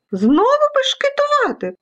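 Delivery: very high, indignant outburst; high-pitched storybook fury; furious vacuum cleaner. Acted. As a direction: explode at the naughty specks. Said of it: "розлютився пилосос".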